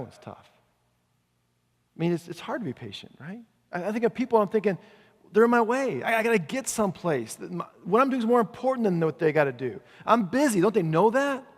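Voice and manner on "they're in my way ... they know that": hurried, self-important grumbling, pitched up; mock impatience. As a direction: act out being too busy for people. Voices own impatient thoughts about people.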